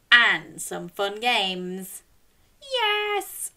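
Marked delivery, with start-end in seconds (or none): 2.6-3.5 excited yes. Pretend. Answering for the audience.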